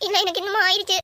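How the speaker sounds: high, breaking into stammers; panicked fear. Acted. Girl telling her rescuer she was harmed, high and panicked. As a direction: near tears, afraid of what he did.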